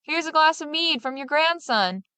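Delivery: high, mock shout of the innkeeper. A narrator's reading. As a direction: call it out high to a deaf old woman. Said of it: "Time after time he shouted it".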